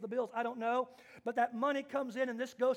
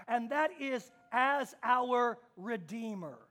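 usual speaking voice

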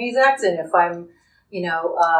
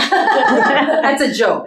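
slow, laughing, higher and louder; 5 semitones higher, 6 dB louder, much slower than usual. Real, not acted. Telling the interviewer her joke, laughing.

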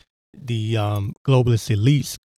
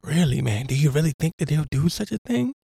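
mock naive disbelief; 4 semitones higher than usual. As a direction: play dumb with a wide-eyed high voice. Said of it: "And he's just like".

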